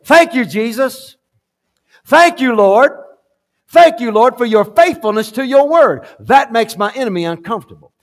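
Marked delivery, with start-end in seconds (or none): 0.1-6.1 loud, high, fervent thanksgiving; shouted praise. Shown as a demonstration. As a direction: call it out to the Lord with full force. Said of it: "I think it'd be good if we would just say".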